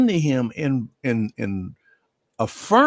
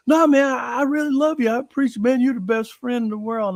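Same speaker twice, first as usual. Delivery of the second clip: emphatic declaration of love; 7 semitones higher, faster than usual. Retold or imitated. Mike shouting his love to his best friend, emphatic and high. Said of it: "but he was just".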